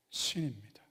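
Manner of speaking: low, near-whispered punchline; hushed reveal. Shown as a demonstration. Preacher giving the congregation the answer, hushed reveal.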